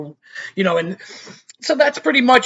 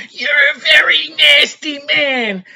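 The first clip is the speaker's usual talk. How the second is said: high, slow mock yell of an outraged wife; imitating the wife; 8 semitones higher, 3 dB louder, slower than usual. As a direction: ham up the wife shouting at him. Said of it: "his wife goes".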